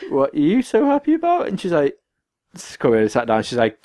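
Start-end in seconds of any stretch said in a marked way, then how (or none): 0.1-1.5 high-pitched, suspicious query; puzzled question. Retold or imitated. Asking grinning mum what's up, high and puzzled.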